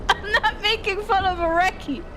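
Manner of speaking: high, squeaky words through laughter; helpless laughter. Real, not acted. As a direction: try to explain while cracking up. Tries to explain while laughing.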